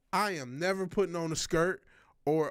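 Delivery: usual speaking voice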